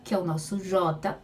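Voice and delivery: ordinary talk, nothing marked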